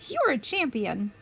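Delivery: plain reading voice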